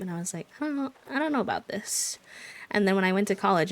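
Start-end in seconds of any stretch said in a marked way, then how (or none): 0.6-2.2 high, hesitant mock unsure voice; doubtful. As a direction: skeptical sing-song self-quote. Quoting own past doubtful reaction.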